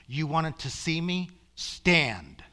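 firm, loud divine order, not mean; matter-of-fact, powerful command. Retold or imitated. God answering the man who saw him, powerful matter-of-fact command. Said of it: "It's just matter of fact, powerful".

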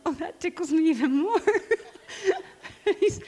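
high, tickled voice breaking into laughter; retold laughing. Counselor hearing another lie from her client, laughing. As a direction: say it through a rising giggle. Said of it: "I'd start laughing".

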